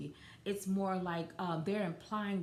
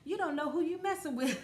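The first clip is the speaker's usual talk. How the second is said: put-on high, louder girly threat; girl voice; 9 semitones higher, 4 dB louder than usual. A woman repeats the threat, now in her high girl voice. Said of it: "I'm doing it in my girl voice".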